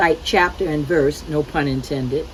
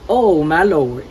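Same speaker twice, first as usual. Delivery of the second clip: mock proclaim; 6 dB louder, slower than usual. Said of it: "you will hear him proclaim".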